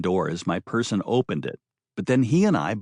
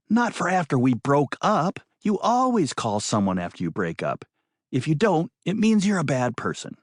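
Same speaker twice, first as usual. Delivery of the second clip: higher, insistent woman's voice; 4 semitones higher than usual. Acted. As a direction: lecture him on the obvious.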